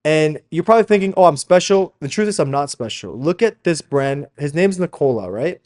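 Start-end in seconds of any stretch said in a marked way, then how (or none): none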